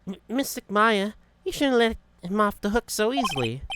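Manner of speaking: high put-on voice, stammered start; scolding game character. Character chiding Mystic Maya for leniency, high and earnest.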